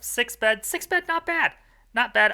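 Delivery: gleeful, can't believe the count; delighted surprise. Real, not acted. Counting bed hits in run.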